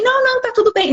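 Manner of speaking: "Não, não, tá tudo bem" agudo e leve, fingindo; mock reassurance. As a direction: finge que está tudo bem. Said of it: "imita resposta fingida". (fingindo calma com voz aguda)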